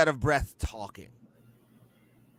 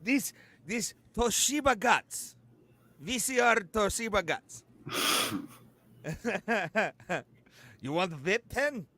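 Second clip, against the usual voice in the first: put-on Russian accent; 4 semitones higher than usual. Continues the Russian bro bit.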